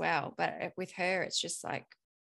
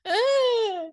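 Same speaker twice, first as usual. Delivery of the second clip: put-on high loud frustrated toddler squeal; toddler shriek; 10 dB louder than usual. Toddler daughter squealing at her mother's look, high and loud. She would just be like.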